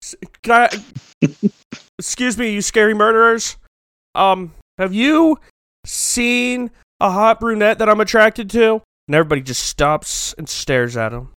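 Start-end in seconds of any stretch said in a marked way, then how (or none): 0.0-8.8 high, halting call across a room; hesitant, high-pitched mock announcement; mock shouting out. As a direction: call out awkwardly in a raised pitch. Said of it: "Matthew just shouts out".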